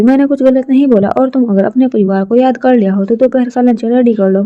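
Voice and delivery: ordinary delivery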